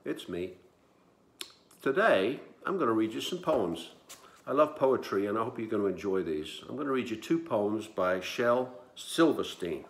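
usual speaking voice

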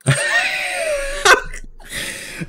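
high shrieking burst of laughter. Real, not acted. Laughing at his partner getting chopped, a high shrieking laugh. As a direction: crack up helplessly.

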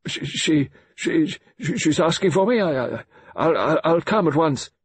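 put-on high, agitated stammer of a shocked old man; fumbling old man. A narrator's reading. Shaken colonel hears his wife wants him, fumbling and stammering. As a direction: stumble over each word, voice pitched right up. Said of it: "fumbling like an old man".

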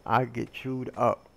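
normal talking voice

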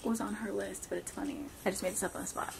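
very soft, near-whispered secret; hushed aside. Real, not acted. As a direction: tell the viewers under your breath. Secret aside so guesser cannot hear.

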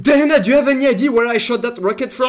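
loud, high, thrilled shout; excited boast. Real, not acted. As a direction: shout your amazing shot to a teammate. Boasting excitedly about a rocket shot.

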